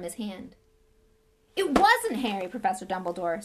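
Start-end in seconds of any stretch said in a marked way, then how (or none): 1.6-3.4 urgently. A narrator's reading. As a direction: burst in pleading, much louder. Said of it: "said Hagrid urgently".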